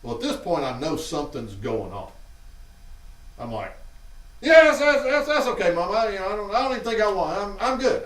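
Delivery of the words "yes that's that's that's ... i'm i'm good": put-on high, loud, flustered kid voice backing out; nervous kid pleading. Nervous boy begging mom not to go in, high and flustered. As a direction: squeak out a nervous refusal to mom.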